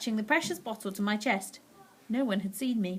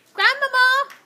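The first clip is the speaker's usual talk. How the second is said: much higher, louder shout; 10 dB louder, slower than usual. A narrator's reading. Mouse boy shouting for grandmother at the door. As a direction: call out as loud as you can. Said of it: "I shouted as loud".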